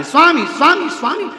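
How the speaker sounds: high, loud dramatized cry; retold wail, pitched far up. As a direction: cry out desperately for your husband, as a storyteller. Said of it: "चीख पड़ी द्रौपदी, आवाज लगा रही है".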